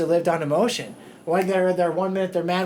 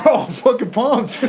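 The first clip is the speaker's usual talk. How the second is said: higher, louder hyped-up shout; pumped-up yell; 7 semitones higher, 8 dB louder than usual. Retold or imitated. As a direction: yell it like you're ready to fight. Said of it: "It's like".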